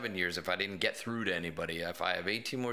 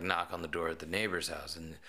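ordinary delivery

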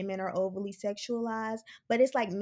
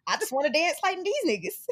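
high mock voice; 7 semitones higher, 4 dB louder than usual. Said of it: "you was like".

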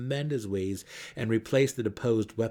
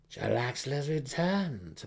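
gleeful snarl; 5 semitones higher than usual. A narrator's reading. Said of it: "Malice snarled in glee".

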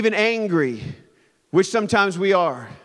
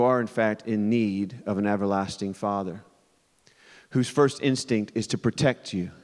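reflective sermon; 7 semitones lower than usual. Real, not acted. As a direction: draw them in gently. Preacher turns reflective.

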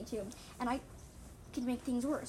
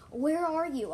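loud, raised call; yelling; 6 semitones higher, 10 dB louder, slower than usual. A narrator's reading. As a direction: yell out for someone missing. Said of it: "I yelled".

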